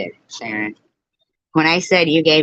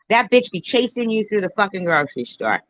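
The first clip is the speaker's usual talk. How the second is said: normal talking voice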